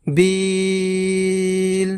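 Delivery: বিল with the ি held very long; long vowel drawn out. Shown as a demonstration. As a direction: stretch বিল slowly. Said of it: "লম্বা উচ্চারণে পড়ব". (বিল, লম্বা টান)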